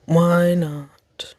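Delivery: ordinary talk, nothing marked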